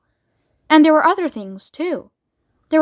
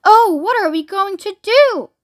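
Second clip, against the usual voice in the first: slow, high-pitched despairing cry; drawn-out theatrical lament; wailing; 7 semitones higher, 3 dB louder than usual. A narrator's reading. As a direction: wail helplessly as a distressed grandma. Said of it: "wailed Grandma Georgina".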